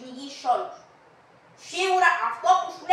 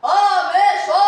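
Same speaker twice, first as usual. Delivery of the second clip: loud call, much higher and louder; shout; 4 semitones higher, 10 dB louder than usual. Acted. Servant yells at whoever knocks, loud and high. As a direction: bellow it through the door.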